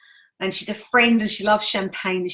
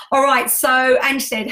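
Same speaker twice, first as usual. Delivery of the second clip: perky restart; 5 semitones higher, 5 dB louder, slower than usual. Real, not acted. Perks up to read comments.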